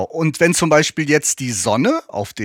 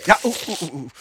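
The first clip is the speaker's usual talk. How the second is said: sipping hot tea; 3 semitones higher, much faster than usual. Real, not acted. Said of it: "Vorsicht, ist heiß".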